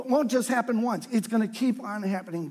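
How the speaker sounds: ordinary delivery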